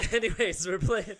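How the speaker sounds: real laughter after the joke; laughing. Real, not acted. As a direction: crack up and push on with the story. Laughing at cheap hooker joke.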